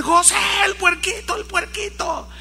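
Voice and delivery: high urgent shout for José; alarmed calling out. Retold or imitated. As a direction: yell across the house that the piglet's gone.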